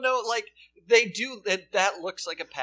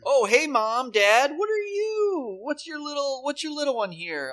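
creepy guy; 9 semitones higher, 4 dB louder than usual. Acted. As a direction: play the unsettling neighbor greeting the family. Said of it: "can you be the creepy guy and go like".

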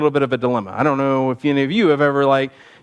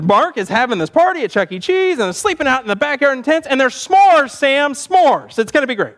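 put-on higher-pitched kid carried away; gushing excitement; 9 semitones higher than usual. Boy telling friend about a better party, gushing. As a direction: gush about it like an eager boy.

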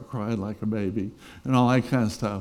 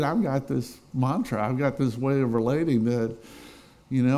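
ordinary talk

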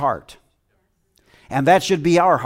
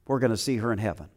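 repeated much softer and quick; 5 semitones lower, 7 dB quieter, faster than usual. Real, not acted. Pastor repeats to the church that they'll see her, softly. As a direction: echo it quietly to yourself. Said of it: "And we're gonna see her in heaven".

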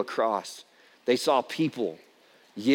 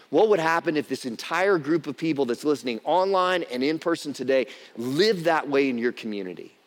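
ordinary delivery

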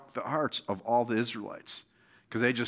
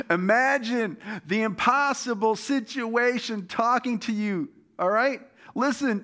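full-force preaching; 9 semitones higher than usual. Acted. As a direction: preach it out to lift the crowd.

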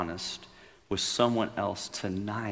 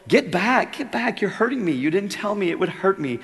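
much higher, louder, frightened cries; panicked ghost; 11 semitones higher, 8 dB louder than usual. Acted. Ghost cries out as the angel approaches, frightened. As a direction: recoil in pain and fear.

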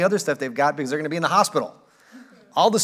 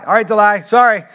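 loud breezy apology; 4 semitones higher, 8 dB louder than usual. Retold or imitated. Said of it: "maybe Delay".

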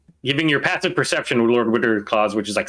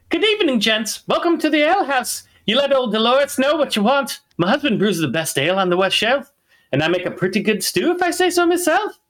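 dwarf barkeep voice; 11 semitones higher than usual. Acted. Dwarf innkeeper welcoming adventurers in a high put-on voice. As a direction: play the cheery innkeeper welcoming travelers. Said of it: "I gotta get her voice".

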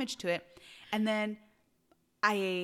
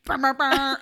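air horn sound; 4 semitones higher, 8 dB louder than usual. Shown as a demonstration. Doing the air horn again.

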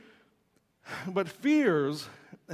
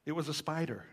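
sheepish; 6 dB quieter than usual. Retold or imitated. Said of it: "the man looked really sheepishly and said".

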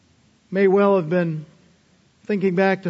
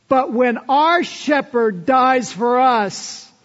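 higher and louder, building to shouted slow lines; full-force preaching; 6 semitones higher, 5 dB louder than usual. Acted. Preacher driving home the shepherd's death to the congregation, higher and louder. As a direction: preach it out, pounding the key words.

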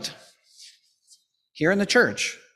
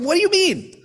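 puzzled priest; 10 semitones higher than usual. Retold or imitated. Retold joke, puzzled priest.

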